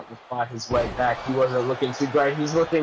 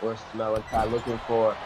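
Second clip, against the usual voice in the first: wrestling commentator. Acted. Live play-by-play commentary.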